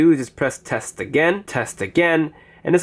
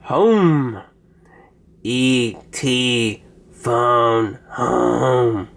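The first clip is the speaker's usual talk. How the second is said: lightly voiced slow alien croak; much slower than usual. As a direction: drag it out like E.T. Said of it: "I want to do an alien one".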